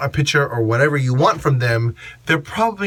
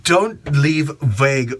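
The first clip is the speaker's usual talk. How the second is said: ordinary talk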